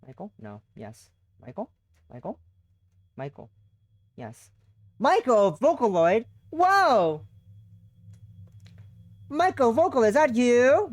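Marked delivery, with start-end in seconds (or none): 5.0-10.9 loud, high, delighted astonishment; excited disbelief. Real, not acted. Streamer reading a silly character name, excited and loud. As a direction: shout the name like you can't believe it.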